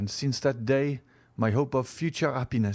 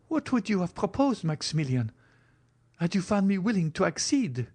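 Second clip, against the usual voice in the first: put-on higher, earnest voice of a girl; young woman asking; 9 semitones higher than usual. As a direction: press him gently, voice raised. Valentine questions Morrel.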